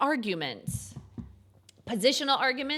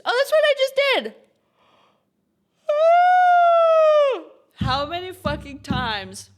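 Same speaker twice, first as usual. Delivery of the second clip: yawning; 12 dB louder, slower than usual. Real, not acted. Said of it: "am I gonna yawn".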